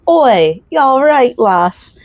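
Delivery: put-on Scottish accent. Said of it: "pretend like I have the accent".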